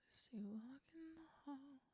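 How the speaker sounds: fast whispered lyric try; quick whisper. Real, not acted. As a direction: rattle it off in a whisper. Whispering draft lyric lines.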